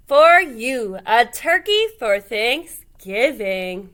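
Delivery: loud, slow grand offering; proud presenting moose. A narrator's reading. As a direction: present it like a gift.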